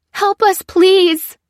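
high, loud desperate cry for help; panicked frantic pleading. Acted. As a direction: scream out a frantic plea. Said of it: "cried the woman".

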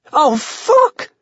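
high-pitched burst of shock; alarmed, high yelp of a curse; startled cry. A narrator's reading. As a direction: cry out as if a ghost just appeared. Carl startled by ghost appearing.

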